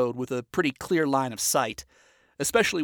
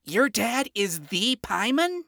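higher, slow stunned question; shocked young imp, high and slow; 8 semitones higher, slower than usual. A narrator's reading. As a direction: gape at him as you ask. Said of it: "gaped at him in shock".

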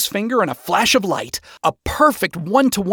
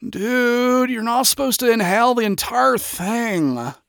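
higher, lazy stoner voice; lazy response; 7 semitones higher than usual. A narrator's reading. Smoking sinner to coughing newcomer, lazy. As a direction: say it lazily, dragging the words. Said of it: "was the lazy response".

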